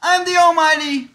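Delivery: loud, high godlike boast; grandiose boast. Pretend. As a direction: proclaim yourself almighty.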